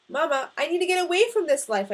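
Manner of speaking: loud, high, urgent plea; pleading. A narrator's reading. Son begs mother to let him leave, urgent pleading. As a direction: beg her to let you go. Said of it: "I pleaded".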